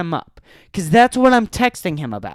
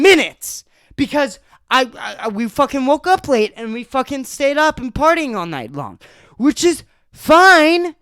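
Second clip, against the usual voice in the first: higher, louder, heated venting; furious rant; 6 semitones higher, 5 dB louder than usual. Real, not acted. Telling listeners how friend left the mess, real furious rant. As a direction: let the anger boil over as you vent. Venting rage about messy basement.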